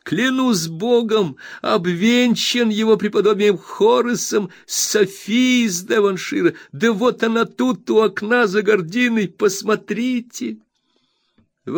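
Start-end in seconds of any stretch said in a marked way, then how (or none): 0.0-10.6 high, bright-eyed friend overjoyed; eager Traddles. Acted. Traddles reassures his old friend Copperfield, eager high voice. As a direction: gush with happiness at the reunion.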